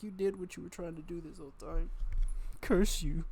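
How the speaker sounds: mock scolding. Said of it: "I'm like".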